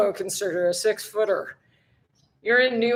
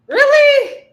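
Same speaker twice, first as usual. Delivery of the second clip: much higher, loud and incredulous; a sky-high disbelieving squeal; surprised; 12 dB louder than usual. Real, not acted. Reacting to a viewer's comment with a high, loud disbelieving squeal.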